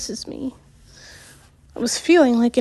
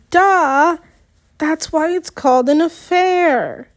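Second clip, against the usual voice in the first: sarcastic; 5 semitones higher than usual. Acted. Sarcastic retort.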